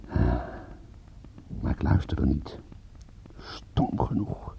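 slow, hushed self-reproach; rueful aside. Acted. Detective privately admitting he ignored the voice of reason, rueful whisper. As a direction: whisper it slowly, as a private admission.

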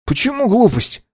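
high, mock-surprised question; fake surprise. A narrator's reading. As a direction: feign innocent surprise. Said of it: "притворно удивился Саймон".

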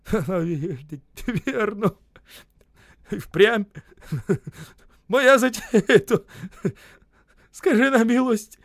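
high, broken sobbing laugh; laughing or crying. A narrator's reading. Осип owning his scheme, laughing or weeping. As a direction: stammer it out between tearful chuckles. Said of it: "смеясь или плача".

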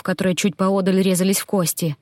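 ordinary delivery

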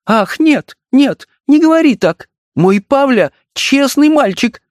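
high, showy protest; a staged, gesturing outcry; dramatic exclamation. A narrator's reading. Wife defends son with a theatrical gesture. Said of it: "воскликнула с жестом".

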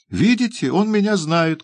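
very high, triumphant appeal; crying out. A narrator's reading. Nancy calling on the bystanders as witnesses, crying out. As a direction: call out shrilly to the bystanders. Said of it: "крикнула Нэнси".

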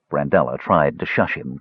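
ordinary delivery, nothing marked

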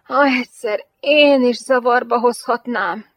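impassioned, wistful wish; darkly yearning sigh. A narrator's reading. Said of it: "sóhajtotta a férfi sötéten vágyakozva".

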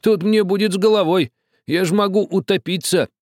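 high, loud protest; protesting old man. A narrator's reading. Old man protesting to the captain. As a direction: object in alarm.

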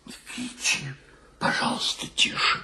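voiceless, urgent near-whisper; hushed plea. Acted. Hushing friend so landlady won't hear, whispered plea. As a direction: drop right down and beg for quiet.

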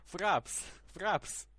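calling out loud. Real, not acted. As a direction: shout the word so they hear. Shouting down a bad line.

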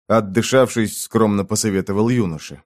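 ordinary delivery

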